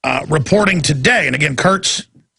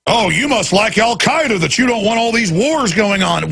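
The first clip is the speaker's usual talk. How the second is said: higher, whiny mock voice; mock accuser; 6 semitones higher than usual. Mocking the critics who call him pro Al-Qaeda, higher whiny voice. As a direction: parody the smug critic. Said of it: "I'm supposed to".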